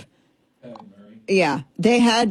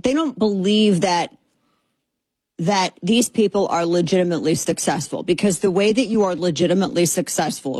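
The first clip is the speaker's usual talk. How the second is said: ordinary delivery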